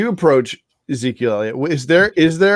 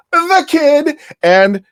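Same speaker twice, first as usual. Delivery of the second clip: announcer voice; 5 dB louder, much slower than usual. Pretend. Introducing hosts with a rising announcer voice.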